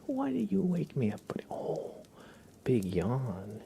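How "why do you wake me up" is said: high mock pet voice; voicing the pet. Streamer voices her pet Sprout woken up, high mock whine. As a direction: speak for the sleepy pet. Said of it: "Sprout's like".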